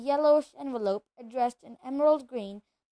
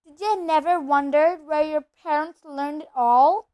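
raised, exasperated voice; 4 semitones higher, 7 dB louder than usual. Acted. Hagrid exclaims to Harry about his parents, exasperated. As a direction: cry out in mild disbelief. Exasperated character outburst in dialogue.

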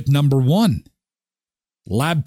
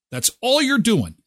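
normal talking voice

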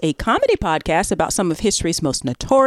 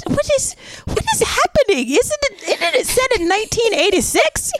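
high-pitched baffled protest; incredulous; 12 semitones higher than usual. Retold or imitated. Host reacting to people doing mammy voice, high incredulous. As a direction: squeak in mock disbelief.

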